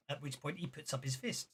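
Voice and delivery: ordinary talk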